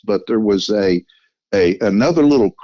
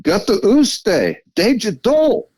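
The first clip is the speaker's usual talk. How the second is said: Gata uste, deja do sung out much higher and slower; more expressive; 12 semitones higher, slower than usual. Retold or imitated. Calling out to the creature again in Cherokee, much higher and slower. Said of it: "I said it more expressively".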